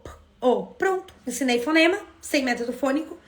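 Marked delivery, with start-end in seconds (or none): 0.0-0.7 p and o said apart; sounding out phonemes. Shown as a demonstration. Teacher sounding out po for children. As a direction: split po into its two sounds. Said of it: "Como que é o po".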